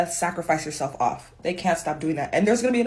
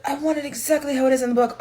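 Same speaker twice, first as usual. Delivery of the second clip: higher, faster whiny demand; mock book purist; 7 semitones higher, faster than usual. Book purists demanding faithfulness, higher and faster whining. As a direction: whine it like a fussy purist. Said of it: "People are like".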